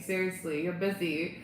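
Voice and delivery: usual speaking voice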